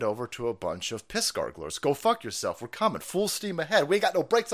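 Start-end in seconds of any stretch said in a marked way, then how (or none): none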